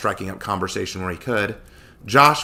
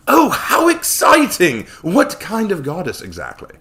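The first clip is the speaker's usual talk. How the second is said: loud, high, slow gushing; eager Samuel; 10 semitones higher, 9 dB louder, slower than usual. Acted. Samuel gushing eagerly over the goddess. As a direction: gush with greedy delight.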